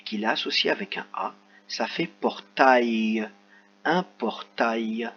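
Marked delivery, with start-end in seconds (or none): 2.1-5.1 portail said slowly, each sound drawn out; slow, careful portail; slow model reading. Shown as a demonstration. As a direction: read ça fait portail slowly for children. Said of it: "teacher models the word".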